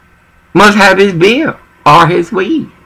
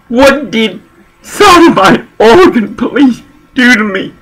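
pitched-up, demanding question; angry accusation; 7 semitones higher than usual. Real, not acted. Speaker at hearing demanding answers from police, angry accusation. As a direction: throw the question at them, outraged.

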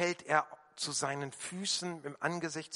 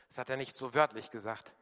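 usual speaking voice

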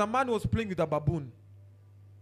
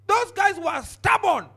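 slow, loud, higher shout; shouted emphasis; 9 semitones higher, 6 dB louder, slower than usual. Acted. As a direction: hammer the point with a shout. Preacher shouting.

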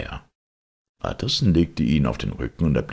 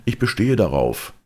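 raised, higher, insistent voice; loud demand; 7 semitones higher, 6 dB louder than usual. A narrator's reading. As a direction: demand it loudly through the door. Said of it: "rief er mit lauter Stimme". (laute, nachdrückliche Forderung)

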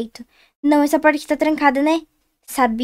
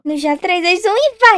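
contagem regressiva animada; 4 semitones higher, 5 dB louder than usual. Real, not acted. Contagem regressiva para pular.

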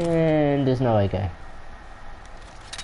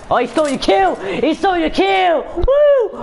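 high, loud excited yelling; 5 dB louder than usual. Real, not acted. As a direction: hype it up at full volume. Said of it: "I'm gonna stop yelling".

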